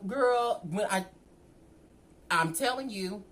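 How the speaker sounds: ordinary delivery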